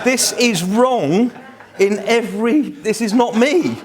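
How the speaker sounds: high, slow, emphatic protest; hammed-up horror, pitched well above normal; mock dismay. Young fan appalled by the crowd's chant, mock dismay.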